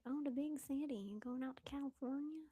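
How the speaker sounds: lightly put-on Southern twang; Southern accent. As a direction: play a nosy small-town Southerner. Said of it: "in a Southern accent".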